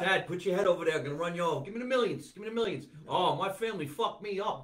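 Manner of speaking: high, loud comic outburst; mock frantic shouting. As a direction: play-act yelling at dad for the millions. Acting out running over family for millions.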